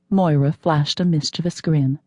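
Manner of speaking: ordinary delivery, nothing marked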